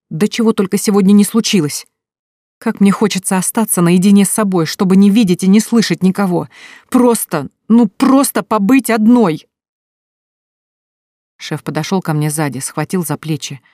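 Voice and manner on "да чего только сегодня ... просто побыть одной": loud outburst building higher and louder, slowing at the end; exploding. Acted. Lilya snapping at her boss about the day, loud exasperated outburst. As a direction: burst out, fed up with everyone. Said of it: "Я взорвалась".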